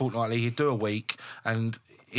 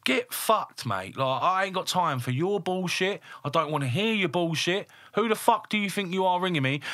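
high, indignant telling-off without shouting; exasperated rant, pitched well up; retold raging; 8 semitones higher than usual. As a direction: re-enact letting rip at a pestering caller. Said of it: "I was raging".

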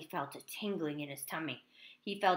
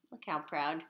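ordinary delivery

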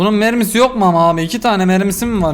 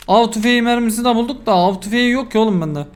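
ordinary talk

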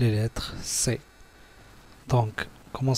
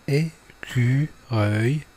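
écureuil slowly split as é, cu, reuil; écureuil drawn out with gaps between syllables; 4 dB louder, much slower than usual. Shown as a demonstration. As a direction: say écureuil in three separate syllables. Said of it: "les mots avec les syllabes".

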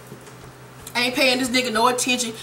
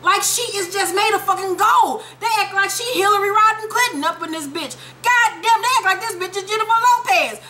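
high-pitched, exasperated mockery; incredulous rant; 8 semitones higher than usual. Real, not acted. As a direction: rail in disbelief, voice pitched way up. Incredulous rant about adored character.

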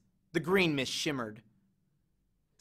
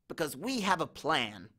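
trembling; 6 dB quieter than usual. Acted. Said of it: "trembling next to me".

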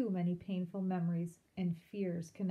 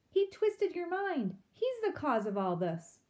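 angry; 9 semitones higher, 4 dB louder than usual. A narrator's reading. Father to daughter, blaming Ragweed, angry outburst. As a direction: rage at the boy who led her astray.

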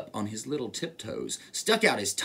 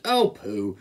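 low, lightly voiced mouse; low storybook scoff; dismissive mouse; 7 semitones lower than usual. A narrator's reading. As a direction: brush it off as the mouse, pitched down. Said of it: "The mouse laughed".

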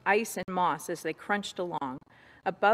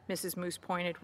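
ordinary delivery, nothing marked

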